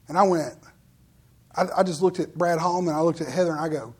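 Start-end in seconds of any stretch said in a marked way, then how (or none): none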